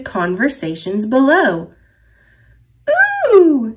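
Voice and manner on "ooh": high, loud delighted squeal; excited squeal. A narrator's reading. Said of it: "squealed Birch".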